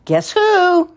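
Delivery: high loud put-on voice; child in disguise at the door, high and loud; silly disguise voice. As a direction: play the disguised visitor.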